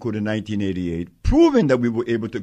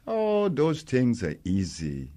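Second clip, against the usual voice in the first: ordinary talk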